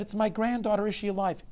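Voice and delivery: high, loud desperate question; worried old man, voice pitched high; anxious grandfather. A narrator's reading. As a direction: ask frantically for news. Anxious grandfather asks for news.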